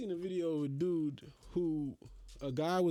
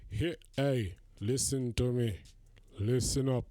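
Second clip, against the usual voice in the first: lightly put-on Jamaican patois, a bit lower and slower; mock Jamaican patois; 5 semitones lower, slower than usual. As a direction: tease him in a hammed-up Jamaican accent. Mocking gym filmers in put-on patois.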